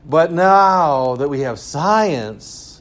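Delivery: mock-grand champion of science; voice of modernity. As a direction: announce progress slowly and emphatically. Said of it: "you know, says modernity".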